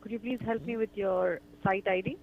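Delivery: usual speaking voice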